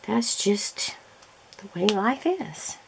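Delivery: soft unvoiced whisper of resignation; a whispered shrug about seasonal allergies. Real, not acted. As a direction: breathe it out as a shrugging aside.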